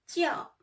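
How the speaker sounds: 叫 held high and level; first tone. Shown as a demonstration. Teacher models 叫 high and level for students. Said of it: "first tone".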